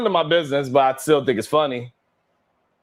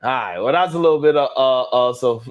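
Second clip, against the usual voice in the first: usual speaking voice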